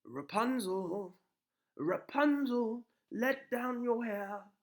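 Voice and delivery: high, loud, slow calling prince; calling up to the tower window, drawn out; loud slow call upward. Acted. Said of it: "called out to Rapunzel".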